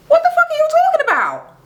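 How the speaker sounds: real disbelieving outcry; stunned outburst. Real, not acted. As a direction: react in shock at what she said. Outraged reaction to Juicy.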